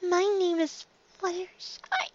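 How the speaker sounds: high, soft and slow; shy pony repeating her name softly; timid and quiet. A narrator's reading. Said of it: "took a two steps back".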